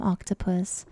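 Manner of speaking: ordinary delivery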